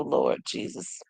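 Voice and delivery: whispered low, flustered; flustered hushed whisper; breathless whisper. Real, not acted. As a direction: let it slip out as a hushed whisper. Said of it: "I'm talking like this".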